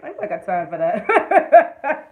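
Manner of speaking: slow words breaking into laughter; laughing dismissal. Real, not acted. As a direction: laugh it off as you say it. Laughing off her friend's worries.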